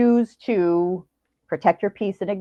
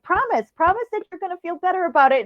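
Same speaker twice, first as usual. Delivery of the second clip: pleading fan voice; 9 semitones higher than usual. Retold or imitated. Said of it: "she's like".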